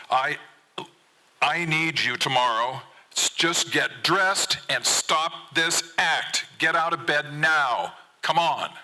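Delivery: loud, high, slow angry yell; out-shout him; father yelling at his son to get up. Acted. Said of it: "I yell back".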